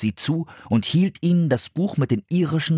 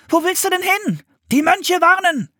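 very high, hurried-sounding exchange; question, then urgent answer; high-pitched child in alarm; urgent children's voices; 4 dB louder than usual. A narrator's reading. Children's urgent exchange fleeing danger.

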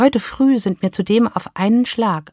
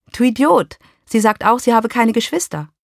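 higher, louder exasperated shout; exasperated scolding; 5 semitones higher, 4 dB louder than usual. Acted. Wife scolds her ex-husband again, loud and exasperated. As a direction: tell him off, fed up.

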